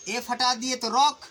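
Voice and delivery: ordinary talk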